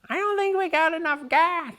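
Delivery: high, put-on Lou voice; Lou's high voice. Lou worries about gas, high put-on whine.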